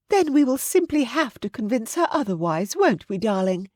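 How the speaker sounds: high-pitched, coaxing aristocratic mother; scheming mother. A narrator's reading. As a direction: play a meddling mama in a lifted voice. Mother voiced, plotting with son.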